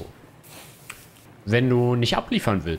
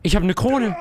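excited, higher repeat of the boast; 9 semitones higher, 4 dB louder than usual. Real, not acted. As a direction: boast about it louder. (aufgeregt angeberisch)

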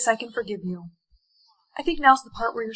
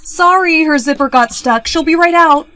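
loud call through a door; 3 semitones higher, 9 dB louder than usual. Acted. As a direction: project an excuse to someone outside. Calls out from fitting room.

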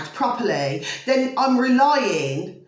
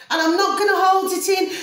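raised, higher and louder, letting the frustration out; refusing to hold the anger in any longer; angry; 8 semitones higher, 4 dB louder than usual. Real, not acted. Vlogger venting at viewers, openly angry and raised. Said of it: "it really does get you flipping angry".

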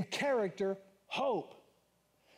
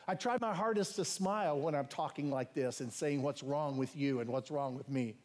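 ordinary delivery

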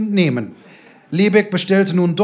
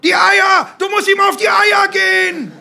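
high, loud touchline roar; full-force high shout; 13 semitones higher, 7 dB louder than usual. A narrator's reading. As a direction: bellow instructions across the pitch. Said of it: "brüllte der Vater".

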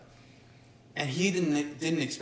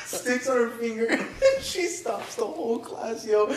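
high-pitched talk broken by laughter; laughing; 6 semitones higher than usual. Real, not acted. Friend telling how the teacher stopped class, laughing. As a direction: squeeze the words out between laughs. Cracking up over spitball story.

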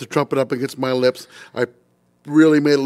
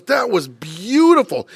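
gushing conductor; 8 semitones higher, 5 dB louder, much slower than usual. Retold or imitated. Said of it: "He goes".

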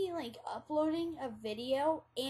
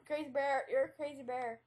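usual speaking voice

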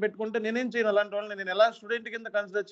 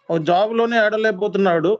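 louder repeat; 8 dB louder than usual. Real, not acted. Trainer scolding students, loud exasperated retake. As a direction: hammer the point home with a louder retake.

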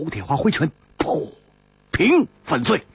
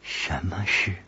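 quiet woman's question; 10 semitones lower, 9 dB quieter than usual. A narrator's reading. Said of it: "金凝之说".

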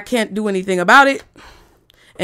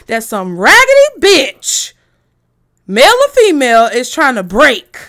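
pissed off; 7 dB louder than usual. Real, not acted. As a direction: spit each word out, really angry. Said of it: "Y'all know I'm pissed off now".

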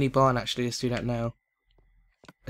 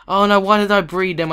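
higher, loud lament; loud dismay; 6 semitones higher, 10 dB louder, faster than usual. Real, not acted. Gamer regrets breeding the sheep, loud dismay. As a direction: cry out at your own blunder.

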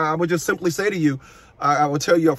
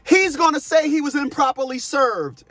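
higher-pitched mimicry of the ex in court; child's mom quoted; 7 semitones higher than usual. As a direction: retell her claim in a raised pitch. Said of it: "my child's mom came in court and said".

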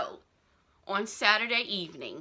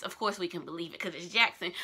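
ordinary talk, nothing marked

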